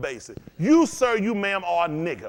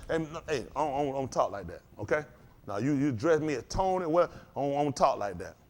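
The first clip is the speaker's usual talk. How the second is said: lower, softer, reserved reply; mock prim refusal; 6 semitones lower, 5 dB quieter than usual. Acting out someone rejecting a street greeting, mildly prim. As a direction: lightly act a man quietly declining slang. Acts out indignant refusal of slang.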